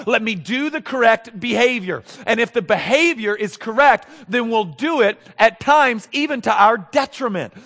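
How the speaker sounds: loud, rising preacher's voice pressing every line; fervent preaching. Acted. Pastor pressing the congregation hard, fervent preaching. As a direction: preach it with swelling fervor to the congregation. Preacher building at full force.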